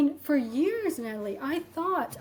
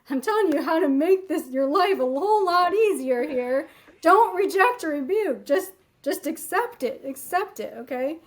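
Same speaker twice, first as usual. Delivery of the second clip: louder, urging lecture to students; insistent teacher; 4 semitones higher, 5 dB louder than usual. Retold or imitated. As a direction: press the students to accept it. Her advice to students.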